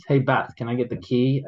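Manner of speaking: normal talking voice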